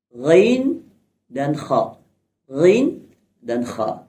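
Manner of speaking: "ghain" voiced and "kha" rasped at the top of the throat; slow, separate "ghain" and "kha" from the upper throat; upper-throat Arabic letters. Shown as a demonstration. Teacher showing upper-throat letters, Arabic fricatives in "ghain dan kha". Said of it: "di bagian atas ada".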